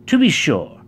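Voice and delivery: high-pitched, confident reply; proud. A narrator's reading. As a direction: answer with pride. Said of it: "he replied proudly".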